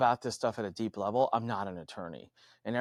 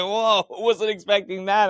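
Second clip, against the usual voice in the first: higher, louder, drawn-out astonishment; retold surprise; 9 semitones higher, 8 dB louder, slower than usual. Speaker reacting to lawyer's sudden outburst, retold surprise. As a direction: act taken aback by the outburst. Retelling own startled reaction.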